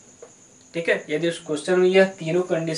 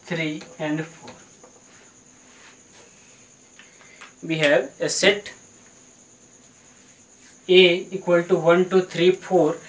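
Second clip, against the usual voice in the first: slow dictation; much slower than usual. Shown as a demonstration. Teacher dictating while writing.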